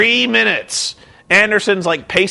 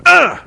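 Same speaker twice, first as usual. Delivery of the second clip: mock grunt of pain; 9 semitones higher, 4 dB louder than usual. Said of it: "Pretty much exactly like it was like".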